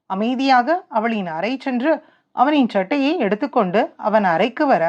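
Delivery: ordinary delivery, nothing marked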